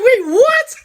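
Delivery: high, loud squeal of disbelief through a laugh; shocked disbelief. Retold or imitated. Hearing her friend will pose as her lawyer, a loud shocked squeal. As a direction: jump way up in pitch, stunned and laughing.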